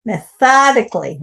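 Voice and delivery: "Methodically" with the stress on "thod"; stress on THO. Shown as a demonstration. Teacher modelling the stress on tho in Methodically. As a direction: push the second syllable of "Methodically". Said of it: "it's on the tho".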